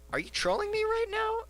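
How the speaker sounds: squeaky falsetto disbelief; incredulous. Retold or imitated. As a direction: voice leaps very high in mock disbelief. Retold disbelief at teammate.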